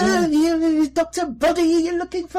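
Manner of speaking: high, loud Rossiter-style butler; Leonard Rossiter impression. Imitating Rossiter as the butler Wadsworth for a friend. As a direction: put on a twitchy high Rossiter voice. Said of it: "The first choice was Leonard Rossiter".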